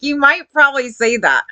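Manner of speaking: ordinary talk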